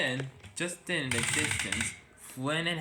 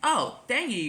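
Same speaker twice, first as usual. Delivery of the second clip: high, louder relieved thanks; happy thanks; 10 semitones higher, 6 dB louder than usual. A narrator's reading. Charlie thanking friends for forgiving him, happy. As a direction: burst out with gratitude. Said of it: "Charlie was happy".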